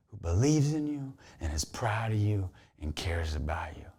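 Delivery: hushed, low, intimate assurance; tender whisper. Real, not acted. Preacher assuring listeners of God's pride, whispered. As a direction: let it sink to a heartfelt whisper. Speaks directly to the viewer.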